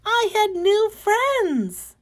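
high, bright cry of joy, slowing at the end; delighted. Acted. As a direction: squeal it out like a thrilled little kid. Caterpillar delighted at new friends.

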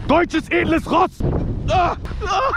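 high, slow excited yell. Real, not acted. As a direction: yell while racing downhill. Shouting while sledding.

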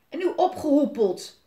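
loud, high pirate bellow; roaring. A narrator's reading. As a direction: bellow to chase the boy off. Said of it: "brult Willem". (brullend wegjagen)

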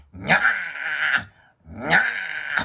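slow, loud stretched meow; drawn-out imitation meow; cat's meow cries. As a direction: yowl like a cat waking you. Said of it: "って言うんですよ".

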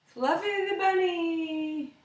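usual speaking voice

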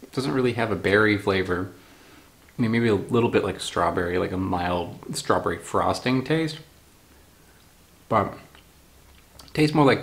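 talking while chewing candy; eating between words; mouth partly full of candy. Real, not acted. Seen 1: bites pink candy at -2 and 6.8, talks while eating.

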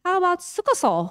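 high-pitched, imitated suggestion; sister's suggestion. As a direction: float the name as a sister's bright idea, pitched high. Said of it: "น้องสาวก็บอก". (เลียนเสียงน้องสาวเสนอชื่อ เสียงสูง)

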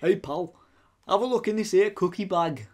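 put-on light goofy northern English accent. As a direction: play the northerner lightly, for laughs. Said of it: "I was just doing something goofy Northern".

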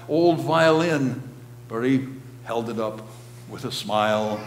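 measured, dramatic poem reading; poem recital. Acted. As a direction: read it like a solemn poem from the pulpit.

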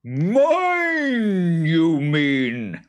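loud, high, drawn-out creature challenge; outraged monster claiming what is his; indignant boggart. A narrator's reading. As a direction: butt in with a loud swooping boggart cry. Boggart interrupts, claiming the land.

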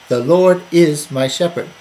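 "is" punched mid-sentence; emphatic "is" to stress the present. Shown as a demonstration. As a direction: lean hard on the word "is". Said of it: "emphasizing it".

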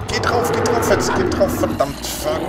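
frantic cursing. Real, not acted. Fighting monster in game.

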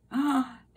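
put-on a sharp, high, surprised gasp for the kids; mock gasp. Teacher reading to her class, a playful gasp at the seagull. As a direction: gasp as if lifting the flap reveals something. Surprise reveal under flap.

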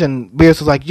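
ordinary talk

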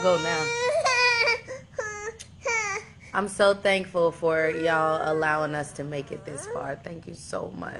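tearful, voice breaking; real tears of joy while thanking fans; choked up thanking the audience; crying. Real, not acted. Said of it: "I'm sorry for crying".

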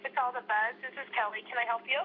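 usual speaking voice

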